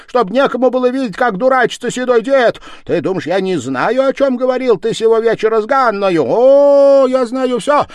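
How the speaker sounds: very high, loud scolding; angry woman's tirade. Acted. Sister-in-law scolds village head, shrieking furious tirade. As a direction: tear into him at full volume, high-pitched. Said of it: "вскричала она".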